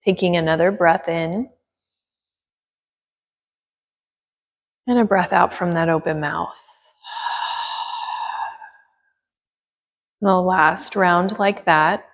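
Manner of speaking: gentle yoga teacher guiding breath and attention; guided meditation. Acted. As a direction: ease them into stillness with long pauses.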